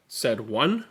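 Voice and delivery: put-on lively read-aloud for children; expressive picture-book reading; story narration. A narrator's reading. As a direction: read it to a room of kids, bright and animated.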